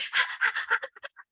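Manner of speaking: high, breathless rapid gasps; re-enacted wordless squeaky panting; retold hyperventilating. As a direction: act too excited to get words out. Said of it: "cannot even get the words out".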